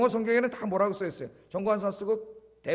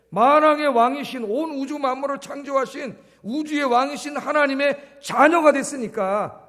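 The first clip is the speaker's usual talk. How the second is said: loud, rising sermon climax; forceful preacher's proclamation; preaching at full force; 6 semitones higher, 5 dB louder than usual. Acted. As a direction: proclaim it to the congregation.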